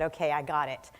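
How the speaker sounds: normal talking voice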